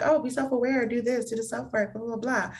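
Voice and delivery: trailing into blah-blah mockery; glib mock advice. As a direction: rattle off self-help talk dismissively. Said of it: "A lot of people are like".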